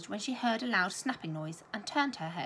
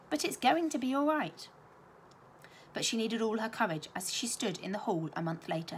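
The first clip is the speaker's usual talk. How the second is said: ordinary delivery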